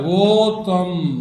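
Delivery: ఓత్వం stretched very slowly; drawn out. Shown as a demonstration. Teacher prompting pupil to remember the vowel sign, drawn out. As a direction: draw out ఓత్వం for the learner. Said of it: "గుర్తుపెట్టుకోవాలి".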